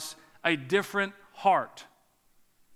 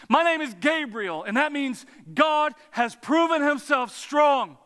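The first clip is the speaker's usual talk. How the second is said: thundering, high-pitched angelic rebuke; Gabriel; 8 semitones higher, 4 dB louder than usual. Acted. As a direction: proclaim it in a forceful shout, putting the doubter in his place. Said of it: "the angel responds".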